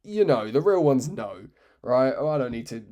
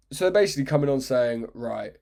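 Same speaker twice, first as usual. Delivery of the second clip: ordinary talk, nothing marked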